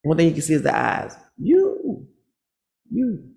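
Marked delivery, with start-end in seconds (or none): 1.4-3.3 high squealing You; mocking the angry man; sassy taunt. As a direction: screech it sassily.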